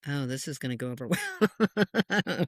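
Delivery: slow, wry aside breaking into laughter; wry laughing. Retold or imitated. Medium recalling her dread before a family reading, wry laughing. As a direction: laugh through the retold thought.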